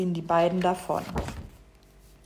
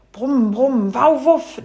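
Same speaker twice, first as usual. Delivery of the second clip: loud storybook animal noises; buzz, then bark higher and louder; playful bee-and-dog scuffle; 4 semitones higher, 7 dB louder, slower than usual. Acted. Acted buzzing and barking scuffle.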